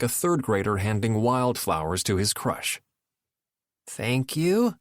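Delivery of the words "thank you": flat robot voice thanks; robot voice, no feeling. A narrator's reading. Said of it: "Nora sounded like a robot".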